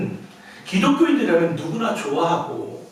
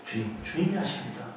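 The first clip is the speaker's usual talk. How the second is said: much lower and much softer, subdued; humble reply; 8 semitones lower, 11 dB quieter than usual. Acted. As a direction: answer meekly, almost ashamed. Peter's humble answer.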